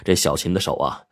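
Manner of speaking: ordinary delivery, nothing marked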